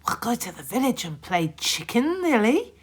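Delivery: put-on boy suggesting a game; eager boy. A narrator's reading. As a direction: pitch the naughty plan. Hector proposes game.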